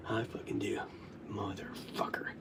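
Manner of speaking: very quiet, low, voiceless mutter; hushed whisper. Real, not acted. Admitting they do remember, whispering. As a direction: let it sink to a whisper. Bitterly admits the memory, drained.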